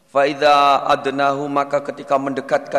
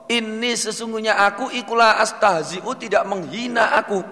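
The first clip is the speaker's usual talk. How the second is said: high assuring voice; 7 semitones higher than usual. Acted. Teacher voices God reassuring man.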